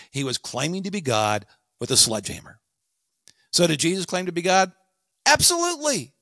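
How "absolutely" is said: high-pitched, emphatic affirmation; resounding, high-pitched confirmation; emphatic yes. Shown as a demonstration. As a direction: answer with total certainty, pitch leaping up. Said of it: "you understood exactly what I meant".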